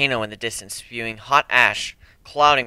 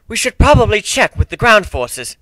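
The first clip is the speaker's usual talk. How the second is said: lightly voiced higher companion; Trixie's suggestion; 9 semitones higher, 8 dB louder than usual. A narrator's reading. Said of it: "Trixie suggested".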